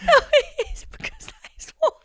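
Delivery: words squeezed out through high giggles; can barely talk for laughing; helpless high-pitched laughter; laughing hard. Real, not acted. Giggling fit at friend's joke.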